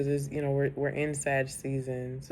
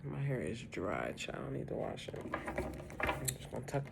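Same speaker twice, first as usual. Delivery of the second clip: quiet aside; 9 dB quieter than usual. Real, not acted. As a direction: say it under the breath. Quiet aside about hair.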